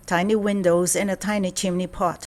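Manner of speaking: plain reading voice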